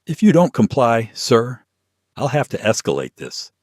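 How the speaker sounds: firm warning, starting high then dropping; hardened voice. A narrator's reading. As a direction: harden slightly into an official threat. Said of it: "Richard's voice hardened".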